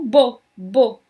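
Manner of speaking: "bo" as an emphatic closed boh; first syllable alone. Shown as a demonstration. Teacher cutting beaucoup down to bo, syllable stressed. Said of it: "tirar a última sílaba da palavra beaucoup".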